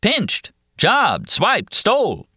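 high, gleeful list of synonyms; merry, helpful explaining; happily. A narrator's reading. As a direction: rattle off each word with cheerful relish. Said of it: "he says happily".

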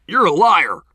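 high, very loud angry shout; thundering yell. Acted. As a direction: thunder it at them. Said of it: "Bo's voice thundered".

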